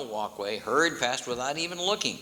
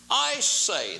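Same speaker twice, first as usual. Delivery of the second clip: pompous king; 10 semitones higher, 4 dB louder than usual. A narrator's reading. King in wine shop.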